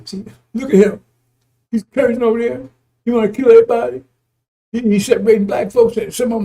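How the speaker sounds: high, loud mock accuser pointing at him; mocking critic. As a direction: ham up the accuser's outrage.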